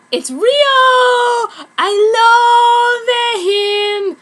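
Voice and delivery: shouted high, then sung long and loud; fangirl squeal. Acted. Author gushing over her favorite character Ryo. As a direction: swoon out loud over your crush.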